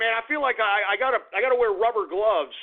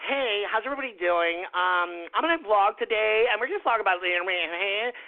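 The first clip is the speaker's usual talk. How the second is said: put-on vlogger greeting voice, mocking. Ranter imitating how YouTubers vlog, mock vlogger voice. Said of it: "the way they vlog".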